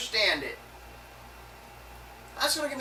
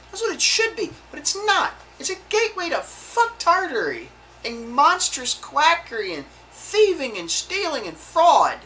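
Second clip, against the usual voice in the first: shouting higher and louder in real fury; angry outburst; 5 semitones higher, 5 dB louder than usual. Real, not acted. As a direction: let the rage boil over, loud and high. Furious rant about quack medicine.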